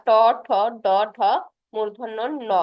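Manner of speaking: ট ঠ ড ঢ and মূর্ধন্য ন said one by one, tongue curled back; teacher listing retroflex letters aloud; retroflex consonant demo. Shown as a demonstration. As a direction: sound out the retroflex row ট ঠ ড ঢ ন. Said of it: "সেটাকে বলছে মূর্ধা".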